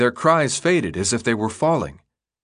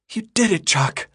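put-on higher, lightly girlish voice for Teresa; Teresa's voice; 10 semitones higher than usual. A narrator's reading. Narrator voicing Teresa as she hugs Chuck, relieved and high. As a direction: read Teresa with giddy relief.

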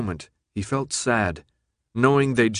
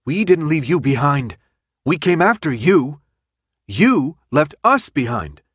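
loud, higher, slowing on the last words; heated protest; 5 semitones higher, 6 dB louder than usual. A narrator's reading. Thomas accusing Teresa of leaving him, loud protest. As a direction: fire back the accusation. Heated argument over being abandoned.

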